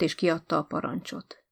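usual speaking voice

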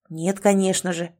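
ordinary delivery, nothing marked

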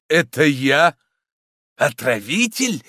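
can't believe the charge; astonished exclamation. A narrator's reading. Priest hearing himself accused, astonished. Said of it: "с изумлением воскликнул аббат".

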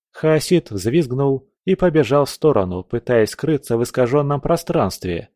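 ordinary delivery, nothing marked

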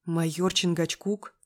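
ordinary delivery, nothing marked

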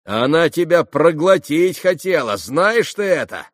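high, loud jeering voice; sneering young man, loud and high; mocking Rakitin. A narrator's reading. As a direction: tease her loudly, almost yelling. Rakitin taunting Alyosha.

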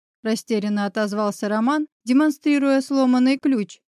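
usual speaking voice

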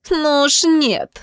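high, slow, spat-out no; contemptuous refusal. Acted. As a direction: refuse with open disdain, drawing it out. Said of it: "сплюнула Белла".

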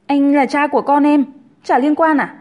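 high, loud indignant retort; indignant claim. A narrator's reading. Pregnant Linh tells Hùng he's the father, indignant. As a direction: insist on your claim, affronted.

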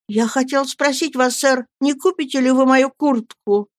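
a hint of a scared boy asking; timid mumble. A narrator's reading. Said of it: "я пробормотал".

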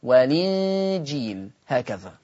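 والإنجيل said slowly, the kasra carried onto the lam, no hamza; naql demo. Shown as a demonstration. Teacher recites the transfer of the vowel for students, slow. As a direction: slide والإنجيل as ولِنجيل, slowly. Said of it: "فتقرأ والإنجيل".

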